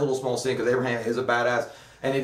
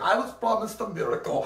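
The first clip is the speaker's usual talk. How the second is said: higher put-on old man's complaint; imitating the dying old man; 7 semitones higher than usual. Host voices the dying old man complaining, higher pitch. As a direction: whine it like a let-down old man.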